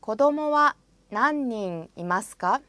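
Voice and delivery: ordinary talk, nothing marked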